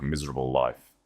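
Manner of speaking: ordinary delivery, nothing marked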